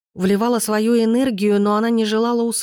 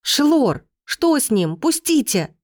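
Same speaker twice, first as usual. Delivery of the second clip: higher-pitched, anxious plea; urgent calling out; 6 semitones higher, slower than usual. A narrator's reading. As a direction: cry out while struggling to get free, without full shouting. Struggling, calling out to Shelor. (взволнованный высокий выкрик)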